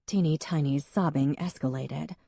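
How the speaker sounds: usual speaking voice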